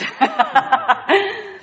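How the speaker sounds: high bubbling laugh. Real, not acted. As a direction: break into an amused laugh. Laughs after own remark.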